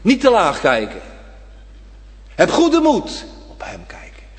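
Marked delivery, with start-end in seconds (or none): 2.3-3.2 high, ringing encouragement; Jesus calling to the disciples, high and encouraging. Acted. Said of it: "quoting Jesus' encouragement". 3.5-4.3 hushed, intimate whisper. Acted. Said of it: "preacher whispers intimately".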